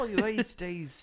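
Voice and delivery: hammed-up Australian accent; mock Australian. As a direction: stretch it like a cartoon Australian.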